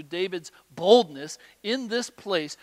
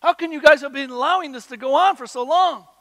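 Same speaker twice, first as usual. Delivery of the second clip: much louder, higher indignant outburst; frustrated David, loud and high; angry David; 8 semitones higher, 11 dB louder than usual. Retold or imitated. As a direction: voice David's outrage at the cowards. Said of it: "He's angry".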